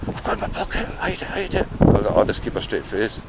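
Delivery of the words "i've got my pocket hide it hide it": whispered, higher panicky townsfolk; imitating jumpy people hiding their stash; mock panicked whisper. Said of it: "and they're".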